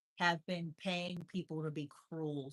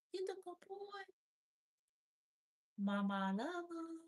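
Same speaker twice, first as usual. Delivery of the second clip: high, soft praise for the cat; 10 semitones higher, 3 dB quieter than usual. Real, not acted. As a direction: coo softly at him. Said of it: "I talk like that 'cause he likes when I make the funny sounds".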